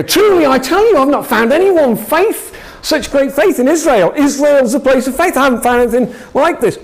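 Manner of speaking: voicing Jesus thrilled at finding faith; excited Jesus. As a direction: declare it with mounting excitement. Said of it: "He starts to get excited".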